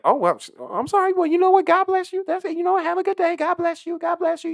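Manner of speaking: very high, loud sugary lady; high mocking lady voice. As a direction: mimic her saccharine politeness.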